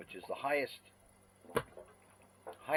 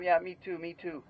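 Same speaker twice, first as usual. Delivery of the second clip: imitating the foreigner; 7 semitones higher, 7 dB louder than usual. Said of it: "He said".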